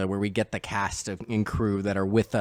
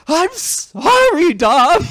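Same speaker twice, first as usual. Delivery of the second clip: high, stretched-out screamed apology, much louder; hammed-up scream of regret, slow and high; anguished yell; 11 dB louder, much slower than usual. Brian screams his apology to Dom, howling. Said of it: "and then Brian yells".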